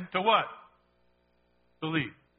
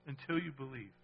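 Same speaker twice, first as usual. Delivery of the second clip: much softer, low repeat of the phrase; soft echo; 4 semitones lower, 9 dB quieter than usual. Acted. Preacher softly echoing his key phrase, hushed. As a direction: let it fall to a near hush.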